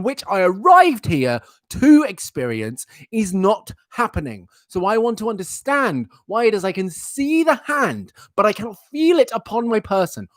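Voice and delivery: pompous dog complainant, high and measured; Dexter. Acted. Dog demanding his owner explain the missing strokes, pompous pet voice. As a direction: lodge a formal grievance as the aggrieved pet. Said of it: "That's Dexter".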